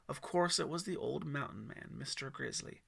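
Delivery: ordinary delivery, nothing marked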